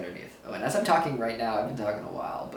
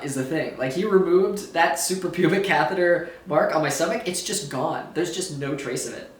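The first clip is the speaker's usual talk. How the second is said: ordinary talk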